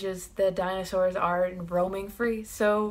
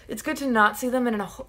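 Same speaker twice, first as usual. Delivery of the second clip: ordinary delivery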